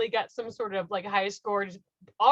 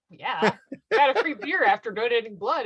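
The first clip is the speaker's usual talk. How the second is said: normal talking voice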